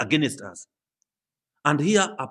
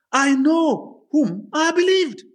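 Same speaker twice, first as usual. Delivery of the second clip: soaring declaration; 12 semitones higher, 4 dB louder, slower than usual. Acted. Preacher voices Paul's faith.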